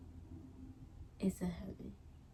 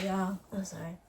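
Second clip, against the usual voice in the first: usual speaking voice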